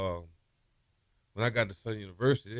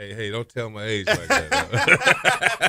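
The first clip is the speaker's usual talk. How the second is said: loud, drawn-out mock objection through laughter; laughing protest; 8 dB louder, much slower than usual. Real, not acted. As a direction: protest loudly while cracking up. Joking protest at age reveal.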